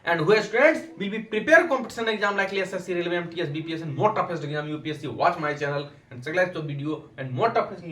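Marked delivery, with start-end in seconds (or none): none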